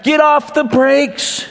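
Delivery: high, drawn-out imitated shout; retold partner's shout. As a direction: yell it slowly like an alarmed partner, pitch way up. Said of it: "he shouted in our car".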